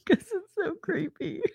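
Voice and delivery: high, drawn-out words through laughter; laughing. Real, not acted. Laughing at the co-host's creepy delivery, laughing. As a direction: squeak it out while cracking up.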